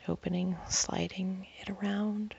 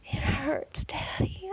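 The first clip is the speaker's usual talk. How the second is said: moaning; 6 semitones higher, slower than usual. A narrator's reading. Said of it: "I moan into the pillow".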